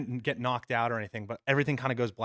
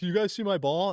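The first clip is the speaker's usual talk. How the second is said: higher, louder mock-casual question; carefree joking; 8 semitones higher, 5 dB louder than usual. Oblivious golfer asking the injured player about his ball, carefree and joking. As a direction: imitate a breezy golfer, voice lifted. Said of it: "laughing, joking".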